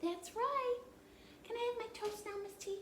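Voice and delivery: put-on high, chirpy little girl; child puppet voice. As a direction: play the curious puppet child. Puppet Annie voice.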